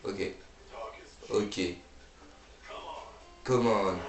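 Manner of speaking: usual speaking voice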